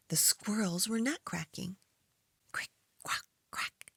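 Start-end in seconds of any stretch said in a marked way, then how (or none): none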